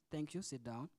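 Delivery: usual speaking voice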